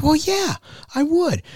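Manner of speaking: high, eager salesman; high telemarketer voice. Retold or imitated. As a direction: answer brightly like the caller. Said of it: "they're like".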